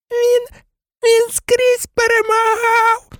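very high, drawn-out squeak; gasped excuse. A narrator's reading. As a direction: gasp it out in a tiny frightened voice. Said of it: "видихнув Петтігру". (високий здавлений писк переляканого)

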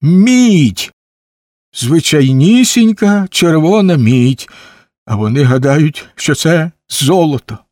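high, loud burst, then drawn-out disbelief; astonished exclamation. A narrator's reading. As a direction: cry out in incredulous dismay. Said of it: "вигукнув він".

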